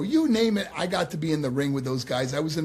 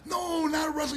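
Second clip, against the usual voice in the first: retold exclamation; 12 semitones higher than usual. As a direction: imitate an amused correction, pitched way up. Retold exasperated correction of listener.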